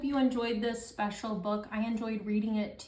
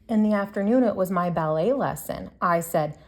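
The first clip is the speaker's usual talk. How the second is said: lively picture-book read-aloud, louder than her chat; reading to children; 6 dB louder than usual. Acted. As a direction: read it out to the class like story time. Teacher reads picture book to class.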